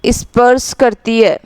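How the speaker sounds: slow dictation, phrase by phrase with long pauses; measured words spaced out for note-taking. Real, not acted. Teacher dictating a definition for students to write, slow dictation.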